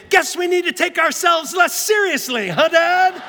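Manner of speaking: loud, very high singsong shout; taunting son. Retold or imitated. Son dancing round the dining room at Dad, taunting. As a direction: crow it gleefully like a teasing kid. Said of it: "he starts dancing around the dining room".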